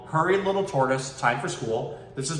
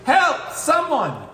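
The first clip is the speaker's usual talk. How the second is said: high, louder distressed cry; calling for help; 5 dB louder, much slower than usual. Acted. Little tortoise flipped over, high distressed call for help. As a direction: call out, stuck on your back.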